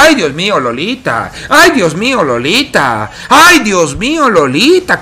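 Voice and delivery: high wailing despair, dragged out; anguished wail. Real, not acted. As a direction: wail at the blunder. Caster wails at a misplay.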